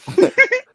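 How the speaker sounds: shrill giggle; high squeal of laughter. Real, not acted. Cast cracks up.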